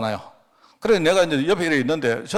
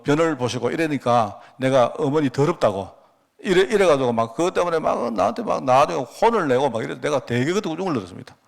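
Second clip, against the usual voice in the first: usual speaking voice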